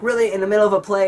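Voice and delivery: high, loud incredulous teacher; mock disbelief, high and loud; mock teacher scolding. Teacher scolding him mid-play.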